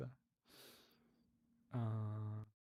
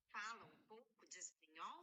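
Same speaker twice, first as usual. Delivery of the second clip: quiet rehearsal; 7 semitones higher, 12 dB quieter than usual. Real, not acted. Quietly rehearsing the Portuguese question.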